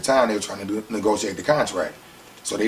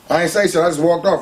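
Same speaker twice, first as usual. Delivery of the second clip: usual speaking voice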